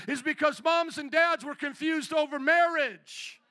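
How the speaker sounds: urgent pulpit delivery, ringing out; forceful preaching. Real, not acted. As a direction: preach it hard to the congregation.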